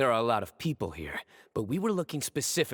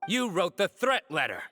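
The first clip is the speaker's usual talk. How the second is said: accusing; 10 semitones higher than usual. Acted. Confronting the culprit.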